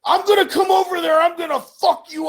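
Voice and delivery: fast, high, loud threat; prank-call voice threatening dad; threatening shout. Retold or imitated. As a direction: yell it like a caller picking a fight. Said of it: "increasingly gets more angry".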